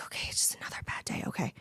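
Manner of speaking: hushed whisper, a bit hurried, talking yourself through it; soft hurried whisper to yourself; whispered self-talk. Retold or imitated. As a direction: whisper it to yourself like a weary mantra. Retold self-talk during bad days.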